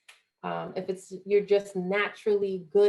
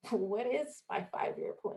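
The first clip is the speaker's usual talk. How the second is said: bewildered echo; 3 semitones higher, 6 dB quieter, slower than usual. Retold or imitated. Bewildered at the question.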